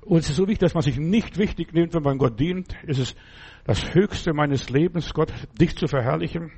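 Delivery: ordinary talk, nothing marked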